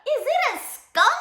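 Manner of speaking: high, loud squeaked question; squeaky bear cub. A narrator's reading. Bear cub asks about the smell, squeaking. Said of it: "squeaked the bear cub".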